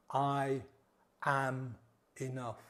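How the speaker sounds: slow mantra with weight on "I" and "am"; stress on I am. Shown as a demonstration. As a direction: lean on "I am", let "enough" fall away. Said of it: "Put emphasis on different words".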